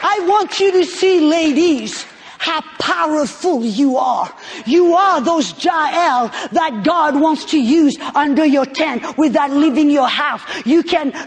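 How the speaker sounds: rally the ladies with fervour; preaching. Acted.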